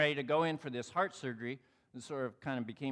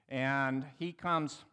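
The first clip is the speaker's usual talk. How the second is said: ordinary delivery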